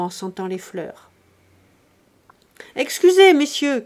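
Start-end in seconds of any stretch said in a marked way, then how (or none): 2.8-3.8 loud, much higher shout; calling out. Acted. Grandet shouts a greeting to his friends from the stairs, loud. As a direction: call out to greet the guests. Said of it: "cria Grandet".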